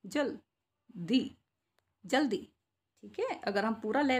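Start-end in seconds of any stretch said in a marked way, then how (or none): 0.1-1.4 जल and दी said apart with a pause; split syllables. Shown as a demonstration. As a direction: break जल्दी into जल, दी. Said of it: "ज आधे ले की साउंड आ रही है". (जल्दी को जल-दी तोड़कर बोलना)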